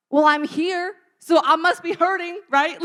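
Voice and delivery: loud and high, half joking; playing the patient baffled by the question; mock indignant patient. Patient protesting to the ER nurse, loud and high. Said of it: "And I'm like".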